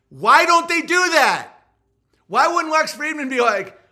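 high, loud incredulous question; excited exclaiming. Real, not acted. Comedian asking why they won't joke back, loud excitement. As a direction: burst out loudly, still fired up. Fired up after the laugh.

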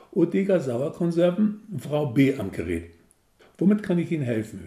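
a brisk, cheeky saleswoman voice; cheeky Berlin receptionist. Acted. Narrator voicing the cheeky Berlin receptionist. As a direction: answer the phone brisk and breezy. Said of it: "in diesem coolen, frechen Berliner Tonfall".

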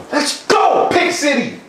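high, thrilled cheer; excited shout. Real, not acted. Celebrating a pick in game.

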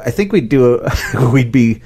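ordinary delivery

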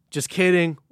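excited. Real, not acted. Joking reveal, louder and higher.

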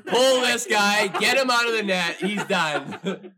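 loud, slow mock shout; loud yell. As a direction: holler it across the bench. Mocking teammate yelling to pull goalie.

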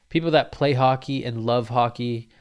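usual speaking voice

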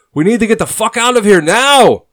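high, urgent full-voiced yell; shouting over chaos. A narrator's reading. Shakespeare urging his comrade to flee over the chaos, shouting. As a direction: yell to be heard over gunfire. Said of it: "Shakespeare says loudly, trying to be heard over the chaos".

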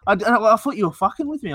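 ordinary talk, nothing marked

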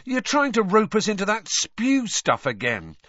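high-pitched accusation; acted suspicious protest; cottoning on. A narrator's reading. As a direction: accuse as it dawns on you. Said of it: "cottoning on".

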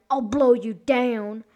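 loud, slow wolf threat; storybook wolf, forceful and slow; threatening wolf. A narrator's reading. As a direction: draw out the threat loudly.

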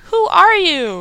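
high, loud startled question; mock-amazed swoop upward; startled woman. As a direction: ask it high, putting on a woman's voice. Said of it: "she's like".

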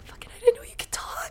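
fast, high whisper in disbelief. Acted. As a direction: breathe it out under your breath, quickly. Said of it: "afterwards is like".

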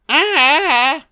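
high-pitched mock wail; mock scream. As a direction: let out a high, drawn-out fake scream. Mimics victims being dragged off.

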